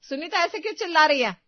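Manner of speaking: loud, high alarmed question; alarmed call. Acted. Mother-in-law rushing in, asking loudly and high. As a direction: call out what's going on.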